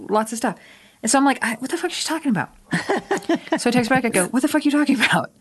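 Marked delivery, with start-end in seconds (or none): 1.6-2.5 high, incredulous, pulled-back voice; baffled inner thought. Retold or imitated. Reliving a friend's baffling text, high and incredulous. 4.3-5.3 high and fast disbelief; incredulous reply. Retold or imitated. Texting back a confused friend, high fast disbelief. Retelling her text reply.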